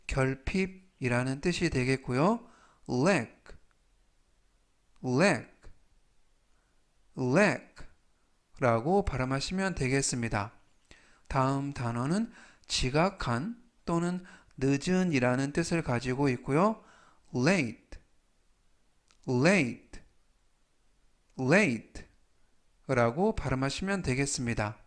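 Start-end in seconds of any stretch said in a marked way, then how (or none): 2.9-7.7 slow model reading. Shown as a demonstration. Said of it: "천천히 세 번씩 읽어드리고요". 17.3-22.0 slow model reading. Shown as a demonstration. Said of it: "천천히 세 번씩 읽어드리고요".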